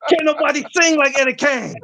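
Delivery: high, lightly voiced showman boast; a hint of a boastful star; mock Eddie Kane. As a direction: lightly play the diva singer. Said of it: "how y'all gonna try to end the show without me".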